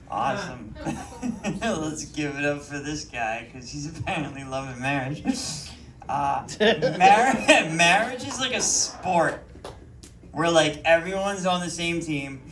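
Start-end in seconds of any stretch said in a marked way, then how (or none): none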